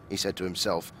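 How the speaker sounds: plain reading voice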